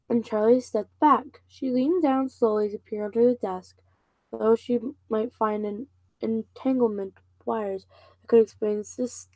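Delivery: ordinary delivery, nothing marked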